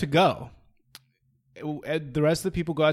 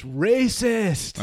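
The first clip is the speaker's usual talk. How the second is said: mock yell, higher and louder; imitating a woman screaming across a restaurant; retold scream; 6 semitones higher, 6 dB louder than usual. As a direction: belt it out like a furious accusation. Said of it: "she screams".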